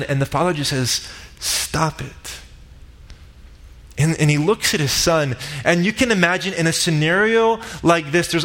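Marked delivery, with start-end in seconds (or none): 1.4-2.4 gentle hush. Retold or imitated. Father hushes the son's plea.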